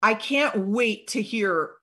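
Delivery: ordinary talk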